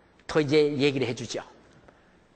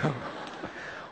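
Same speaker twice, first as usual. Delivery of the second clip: breathless, nearly silent chuckle; embarrassed laugh; 3 semitones lower, 11 dB quieter than usual. Real, not acted. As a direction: laugh off the slip under your breath. Laughs at own bad comparison. (민망한 듯 숨죽여 웃음)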